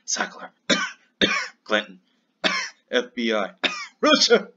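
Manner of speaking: cough-masked name drop; words hidden in coughs. Pretend. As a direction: fake cough with each name. Names coughed out as a gag.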